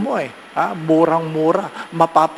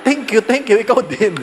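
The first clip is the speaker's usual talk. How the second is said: laughing, a bit louder and higher; laughing thanks; 5 semitones higher, 3 dB louder than usual. Retold or imitated. Priest thanking his seatmate during the dive, laughing. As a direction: thank him through a laugh.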